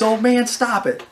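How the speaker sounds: high, loud imitated plea; exasperated protest. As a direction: mimic a rattled friend begging off. Panicked friend protests the teasing.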